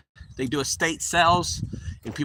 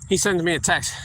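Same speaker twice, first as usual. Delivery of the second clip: normal talking voice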